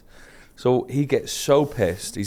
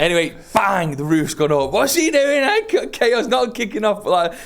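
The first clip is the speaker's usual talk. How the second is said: laughing; 10 semitones higher than usual. Real, not acted. Laughing while telling the story.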